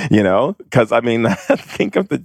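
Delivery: usual speaking voice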